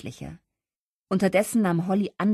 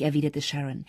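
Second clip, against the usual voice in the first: ordinary delivery, nothing marked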